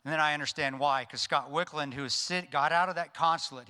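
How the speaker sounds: ordinary talk, nothing marked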